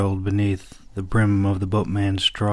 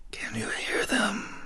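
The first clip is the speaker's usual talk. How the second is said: soft, slow, breathy whisper; hushed secretive whisper; conspiratorial whisper; 11 semitones higher, 8 dB quieter than usual. A narrator's reading. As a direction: lean in and share a secret.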